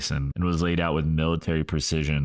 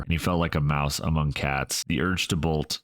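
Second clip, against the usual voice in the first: ordinary delivery, nothing marked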